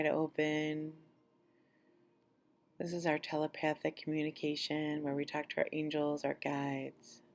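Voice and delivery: calm guided-meditation voice with long pauses. Acted. Leading listeners through an abundance meditation, unhurried meditation voice. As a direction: guide listeners gently into relaxation, phrase by phrase.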